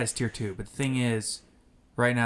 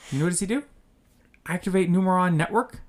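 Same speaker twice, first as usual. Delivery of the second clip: incredulous; 7 semitones higher, 5 dB louder than usual. Retold or imitated. Incredulous retelling of opponent's plays.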